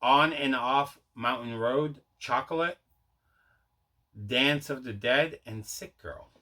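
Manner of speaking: usual speaking voice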